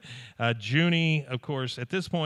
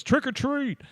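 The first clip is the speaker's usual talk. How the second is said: high, playful trick-or-treat call; mock trick-or-treat; 12 semitones higher, slower than usual. Dad in a devil suit greeting the neighbor at her door, high playful call.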